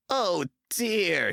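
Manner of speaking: a sad, high oh dear; sighing to himself. A narrator's reading. James alone in the shed, sadly sighing. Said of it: "he thought sadly".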